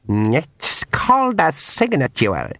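slow Scottish-tinged pixie voice. Acted. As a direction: explain it sweetly as the pixie. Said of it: "he said".

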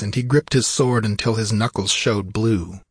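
plain reading voice

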